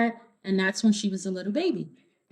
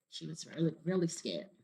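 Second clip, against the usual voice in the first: plain reading voice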